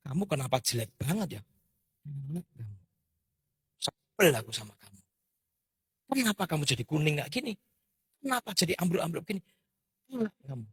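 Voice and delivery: retold irritated nagging at the bamboo; grumbling scold. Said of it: "terus kemudian saya gini".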